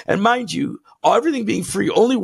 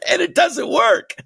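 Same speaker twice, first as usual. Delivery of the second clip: high-pitched, cracking up through the words; laughing outburst; 9 semitones higher than usual. Real, not acted. Host cracking up at a talk show guest's answer, laughing outburst. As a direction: let laughter push the punchline way up. Laughing at own punchline about whiteness.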